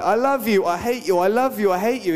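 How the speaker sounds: mock love and hate. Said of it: "It's kind of like".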